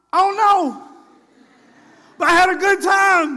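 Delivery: high, loud, carefree; carefree churchgoer. Retold or imitated. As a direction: shrug it off happily, still buzzing. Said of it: "answering the friend".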